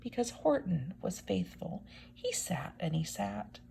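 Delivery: hushed whisper; a whispered storybook line; softly. A narrator's reading. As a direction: whisper it gently to the children. Reading softly to children.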